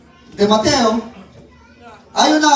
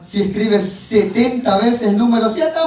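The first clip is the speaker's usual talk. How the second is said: normal talking voice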